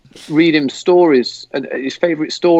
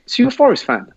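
retold surprise; 9 semitones higher, faster than usual. Fellow passenger asks about the shirt, retold surprise. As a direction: question pitched well up.

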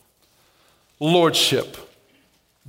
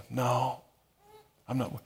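ordinary talk

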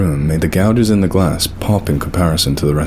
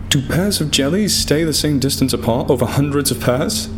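incredulous; 9 semitones higher than usual. A narrator's reading. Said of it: "I asked incredulously".

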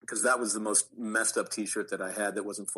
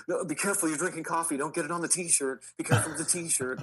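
higher, quick, mock-panicked fussing; fussing wardrobe crew; 7 semitones higher, faster than usual. Costume crew fretting about coffee on the T-shirt, higher mock alarm. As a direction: imitate crew fretting over a precious shirt.

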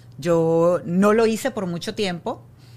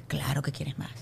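soft, whispered, to herself; whispered aside; 5 semitones lower, 7 dB quieter than usual. Real, not acted. Host musing about grief to guest, whispering softly. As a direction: breathe it out privately.

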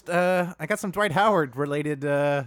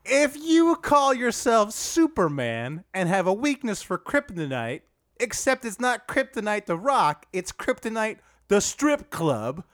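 Dwight Howard voice; 6 semitones higher than usual. Pretend. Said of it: "can we dial that accent up a little".

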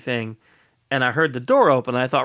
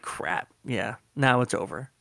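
resigned mutter; 2 semitones lower, 7 dB quieter than usual. Retold or imitated. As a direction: mutter it, beaten. Giving up, dejected.

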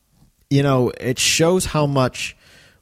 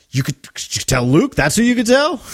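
hammed-up incredulous retort, climbing high and loud; mock-outraged questioning; indignant disbelief; 11 semitones higher, 5 dB louder than usual. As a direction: act offended, voice shooting up at the end. Voicing Han's indignant reply to Leia.

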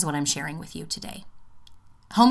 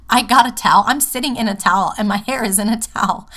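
loud, higher, amused confession; bursting out with a giggle; laughing; 5 semitones higher, 8 dB louder, faster than usual. Real, not acted. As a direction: laugh as you admit something silly. Laughing confession about recording in towels.

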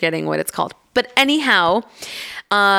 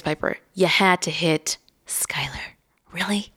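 hushed disbelieving whisper to her daughter; whispered scolding; 4 dB quieter than usual. Retold or imitated. Mother scolding her toddler under her breath, whispered. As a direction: whisper it in mock exasperation. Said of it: "But I was like".

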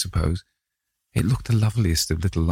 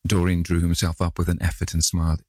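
ordinary delivery, nothing marked

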